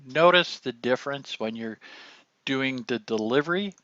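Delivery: usual speaking voice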